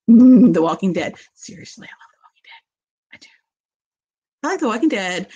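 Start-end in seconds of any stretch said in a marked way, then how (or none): 1.3-3.3 hushed, conspiratorial aside; confiding whisper. Real, not acted. Host confides her favourite show to viewers in a whisper. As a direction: whisper it like a secret.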